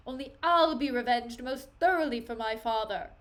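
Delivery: loud, declaimed defiance; theatrical rage, punching key words; acted vengeful fury. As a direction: swear revenge with stage force. Laertes swears revenge in acted quarrel.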